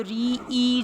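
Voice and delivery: RI stretched slowly to its vowel "ई"; syllable sounded out. Shown as a demonstration. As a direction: draw out "री ई" to show the vowel. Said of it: "तो three syllables हैं".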